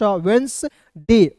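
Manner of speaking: वेंसडे drawn out slowly as "wens... day"; slow teaching model of वेंसडे, D silent; syllable-by-syllable demo. Shown as a demonstration. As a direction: split वेंसडे in two with a pause. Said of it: "इसे पढ़ते हैं".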